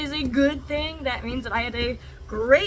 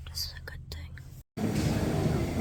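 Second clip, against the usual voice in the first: slow breathy whisper; 6 dB quieter, much slower than usual. Shown as a demonstration. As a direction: whisper it slowly. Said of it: "repeated as whisper".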